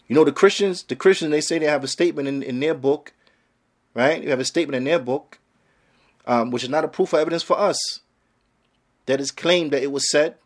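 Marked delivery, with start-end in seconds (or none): none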